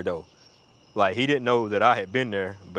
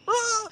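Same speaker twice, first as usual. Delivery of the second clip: very high mock shriek; hammed-up squeal; imitated cry. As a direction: mimic a high cry without real volume. Imitates friend's startled cry.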